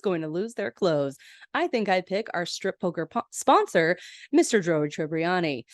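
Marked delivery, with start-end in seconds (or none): none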